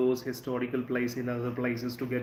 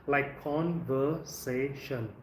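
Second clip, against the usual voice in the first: "conversation" slow, weight on "sa"; drawn out "conversation", stress on "sa" before "tion"; teacher models "conversation"; stress before shun; much slower than usual. Shown as a demonstration. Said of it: "So you have to stress here".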